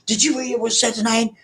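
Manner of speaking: high-pitched disbelieving question; incredulous. Retold or imitated. Quizzing his buddy about the fifteen videos, high and incredulous. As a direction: sound baffled, pitch up high.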